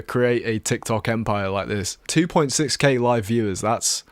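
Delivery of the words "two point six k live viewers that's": ordinary talk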